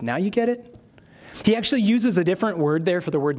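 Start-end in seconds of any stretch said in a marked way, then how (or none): 0.0-0.7 rising, ironic question back; confronting comeback. Acted. Jesus calling the disciples' bluff, ironic and challenging. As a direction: throw their words back, lightly challenging. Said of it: "he slingshots it right back at them".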